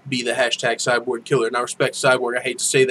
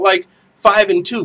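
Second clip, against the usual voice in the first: usual speaking voice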